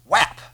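sudden loud, high "whap"; a mock smack, punched out loud; mock smack sound. Mimics Jesus striking the doubter.

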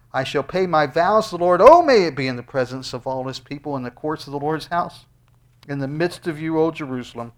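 loud proclaimed psalm, slowing on key lines; preached scripture reading. Acted. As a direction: proclaim the vows to the whole hall. Reads psalm at full force.

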